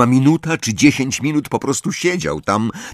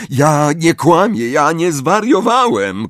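much higher and louder shout; 7 semitones higher, 5 dB louder than usual. A narrator's reading. Harry shouting at McGonagall that he is not lying, desperate. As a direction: shout it to be believed. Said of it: "krzyknął Harry".

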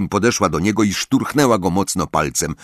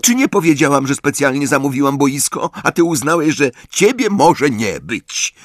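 high, loud indignant scolding; angry team captain; 7 semitones higher, 4 dB louder than usual. Acted. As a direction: tell him off in a raised, high voice.

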